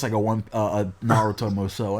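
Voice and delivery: usual speaking voice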